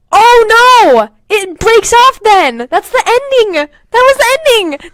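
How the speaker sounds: high, loud, slow wail; dramatic dismay. Real, not acted. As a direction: moan it theatrically.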